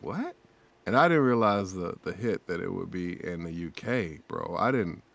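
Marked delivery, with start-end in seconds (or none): 0.0-0.4 high-rising incredulous "what?"; surprised. Retold or imitated. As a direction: pitch shoots up in disbelief. Retelling his surprised reaction.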